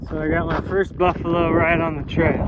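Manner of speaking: normal talking voice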